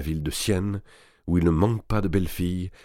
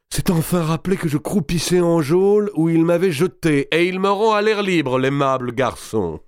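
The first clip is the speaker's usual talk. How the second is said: loud, higher mocking noble ending in a laugh; boisterous lord; 10 semitones higher, 5 dB louder than usual. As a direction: gloat loudly about his release. Robert d'Artois voiced in story. (Robert d'Artois, fort et goguenard, puis rit)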